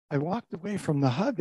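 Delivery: ordinary delivery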